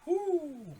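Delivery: high excited whoop; a real cheer of excitement; excited whoo. Real, not acted. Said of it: "I'm excited".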